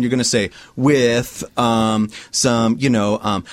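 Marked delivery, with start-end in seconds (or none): none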